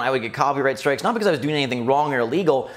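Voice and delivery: usual speaking voice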